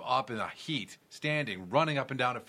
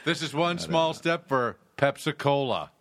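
louder, solemn historic line; grand announcement; 5 semitones higher, 5 dB louder than usual. Pretend. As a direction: deliver it like a moon landing. Parody moon quote as ad.

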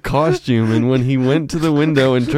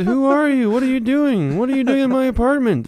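high-pitched, play-acted alarm; acted alarmed challenge; 11 semitones higher than usual. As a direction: confront an intruder in a raised pitch. Acts out the startled woman.

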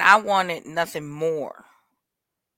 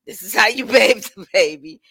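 louder quote, broken by laughs; laughing retelling; 5 semitones higher, 6 dB louder than usual. Retold or imitated. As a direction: repeat the mother's line while laughing. Mother's instruction quoted twice.

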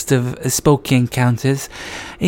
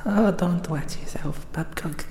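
hushed, half-whispered aside to himself; steady yourself under your breath; whispered self-reproach; nervous self-talk; 9 dB quieter than usual. Acted. Scared host mutters to himself.

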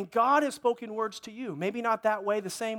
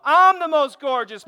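put-on louder, slow, higher boast of a little girl; bragging child; 4 semitones higher, 10 dB louder, slower than usual. Pastor imitating his daughter arguing with her cousin, loud proud boast. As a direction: belt it out like a proud little girl claiming the crown. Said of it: "Mallory is saying".

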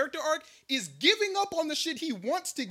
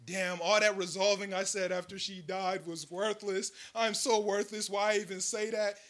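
slow, soft, teary whine; hammed-up sobbing lament, softer; mock despair; 4 semitones lower, 6 dB quieter, slower than usual. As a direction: whimper it in fake self-pity. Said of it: "he went".

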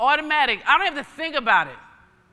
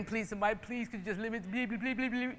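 soft, pleading mock whimper; mock begging; 10 dB quieter than usual. Preacher mocks believers begging God, soft mock pleading. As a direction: play a pitiful beggar, half-hearted. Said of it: "on my knees begging".

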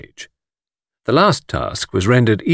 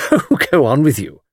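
giggly, higher flirtatious brush-off; coquettish; 5 semitones higher than usual. A narrator's reading. Miss Taylor brushing off a compliment, coquettish. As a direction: wave him off flirtatiously, laughing. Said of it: "cried Miss Taylor coquettishly".

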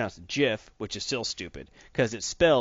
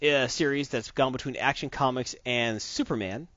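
usual speaking voice

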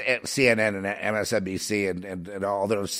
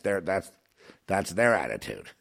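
ordinary talk

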